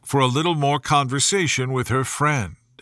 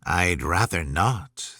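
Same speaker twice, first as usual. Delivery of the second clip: lower, slow, lazy cat voice; sly cat; 8 semitones lower, slower than usual. A narrator's reading. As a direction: let the cat drawl it low and unbothered. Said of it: "the cat remarked".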